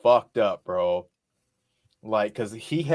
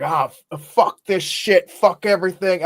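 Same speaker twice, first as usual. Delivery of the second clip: put-on drunken yell; 8 semitones higher, 5 dB louder than usual. Drunk at the festival, yelling at his friend. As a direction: bellow it like you're wasted. Drunk self quoted cursing.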